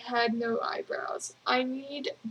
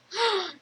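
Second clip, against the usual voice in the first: quick high gasp of mock shock; a playful theatrical intake of breath; 6 semitones higher than usual. Friend's mock shock retold.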